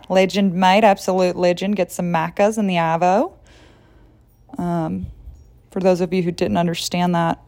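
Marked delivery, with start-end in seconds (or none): 0.1-3.3 put-on Aussie slang voice; Australian accent. For listeners down under, mock Australian accent. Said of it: "down under".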